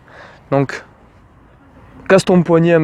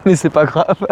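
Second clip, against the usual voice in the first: saying it through a light real laugh; amused laugh. Real, not acted. Coach joking about looking like a fish, amused laugh. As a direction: let a chuckle lead into the words.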